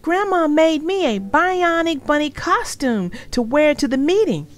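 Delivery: put-on high, loud kid voice; excited little girl announcing; proud little sister. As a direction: show off the costume.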